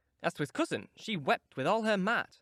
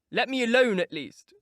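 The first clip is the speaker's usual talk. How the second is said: sharp outburst; 6 semitones higher, 7 dB louder than usual. Acted. Sick boy snapping at cousin.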